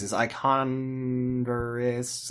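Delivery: milk the pun, stretching it out; drawn-out pun. Pretend. Co-host forcing a shoe pun, dragged out slowly.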